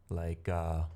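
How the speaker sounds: usual speaking voice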